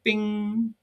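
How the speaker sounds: high, drawn-out "bing"; sustained high ping, held like a chime; imitated phone chime. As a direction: sing out a ringing notification tone. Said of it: "The phone chimed in".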